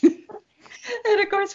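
real laughter breaking into high, slow words; laughing. Real, not acted. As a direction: try to restart through giggles. Restarting the intro while cracking up.

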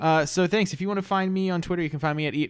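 high, brisk outro patter; mock podcast sign-off. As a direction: launch into a fake goodbye plug. Fake sign-off, then "I'm kidding.

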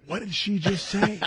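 high, slow mock bafflement; comically puzzled, drawn out; baffled. Retold bafflement at local accent.

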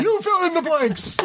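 high-pitched cartoon character voice; cartoony voice. Impressionist showing off cartoony voices, high and silly.

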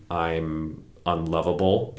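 slow, drawn-out demo of a painful thought; said slowly. Shown as a demonstration. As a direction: stretch each word out slowly. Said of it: "if you say it really slowly".

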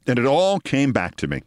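plain reading voice

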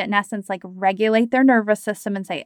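ordinary talk

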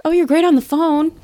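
high-pitched flattering boss; mock gushing praise. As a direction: imitate an employer buttering you up. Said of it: "And then they're like".

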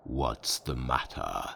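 low, slow, soft demon growl; a deep unbothered monster. A narrator's reading. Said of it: "said the demon".

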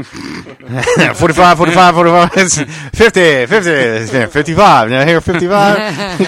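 loud, fast-rolling auction call; auctioneer. Pretend. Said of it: "It's like an auction in here".

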